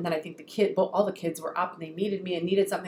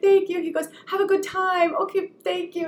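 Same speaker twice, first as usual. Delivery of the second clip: tender thanks; 10 semitones higher than usual. Retold or imitated. Mom answers son tenderly.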